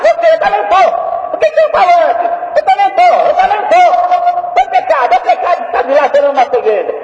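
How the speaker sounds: overwhelmed repentant shouting; frantic confession. Acted. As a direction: cry out under the power. The three under God's power.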